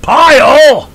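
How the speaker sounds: sudden high, loud barked drill-sergeant yell; Lee Ermey drill sergeant. Retold or imitated. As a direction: bark it like a drill instructor at a recruit. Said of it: "Do you follow in Lee Ermey's footsteps?".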